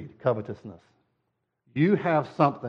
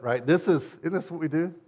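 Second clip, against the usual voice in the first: ordinary talk, nothing marked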